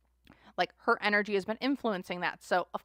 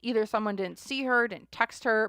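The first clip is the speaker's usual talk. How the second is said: normal talking voice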